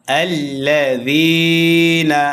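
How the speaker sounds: slow recitation, lengthened "যী" in আল্লাযীনা; madd tabai. Shown as a demonstration. Teacher showing one-alif madd, stretched যী in আল্লাযীনা. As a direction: hold the "যী" of আল্লাযীনা. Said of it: "এক আলিফ টান হবে মাদ্দে".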